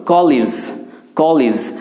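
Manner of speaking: কলেজ said kolij, short i in the last syllable; college as kolij. Shown as a demonstration. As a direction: end কলেজ on lij, not lej. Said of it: "এই সঠিক উচ্চারণগুলো লিজ হয়".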